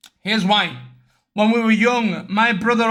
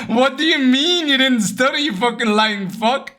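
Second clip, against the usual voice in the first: playfully calling out a sibling; laughing mock outrage; 2 semitones higher than usual. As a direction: burst out laughing into an accusation. Laughing mock outrage at brother's lie.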